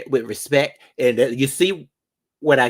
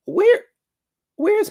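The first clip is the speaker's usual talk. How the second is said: very high squeal; squealed "where". Real, not acted. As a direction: shriek the word up high. Sudden exclaim.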